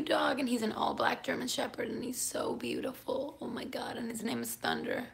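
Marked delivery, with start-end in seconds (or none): 3.4-5.1 hushed gush. Real, not acted. Gushing about new dog.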